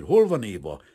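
angry growl. A narrator's reading. Painter cutting off the father to find his fiancée, angry. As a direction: break in forcefully and demand an answer. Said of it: "mordult fel Kazimír".

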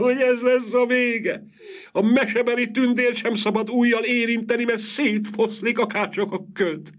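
put-on a housekeeper bewailing the lost young mistress, high and keening; wailing old housekeeper. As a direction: wring the words out in grief, voice pitched high. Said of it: "jaj veszékelt Adamecné a kezeit tördelve".

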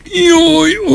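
high shriek of pain; howl of pain. A narrator's reading. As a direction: cry out as if struck by a curse. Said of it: "ordított föl".

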